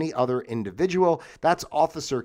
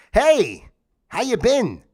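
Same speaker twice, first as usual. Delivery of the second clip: loud, higher, chirpy "Hey, how you been?"; cheery greeting; 9 semitones higher, 7 dB louder, slower than usual. Shown as a demonstration. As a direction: greet the crowd brightly, testing for laughs. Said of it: "let me try it out".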